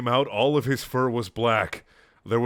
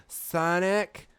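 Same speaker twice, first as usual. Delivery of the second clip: higher, frail, weak-voiced Amy; feeble voice; 8 semitones higher than usual. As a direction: croak it like a starving girl. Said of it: "in a voice like a person who was living poorly".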